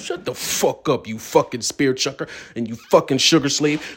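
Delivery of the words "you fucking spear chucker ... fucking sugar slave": normal talking voice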